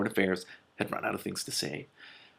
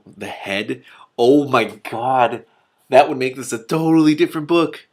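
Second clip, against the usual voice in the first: amused disbelief; 4 semitones higher, 9 dB louder, slower than usual. Real, not acted. Laughing at her own misreading.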